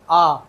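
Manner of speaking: आ drawn out long and loud; teacher mimics the extra long आ; stretched wrong vowel. Shown as a demonstration. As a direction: pull the आ long. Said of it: "एक आ पहले से ही extra लगा देते हैं".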